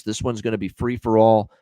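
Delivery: ordinary talk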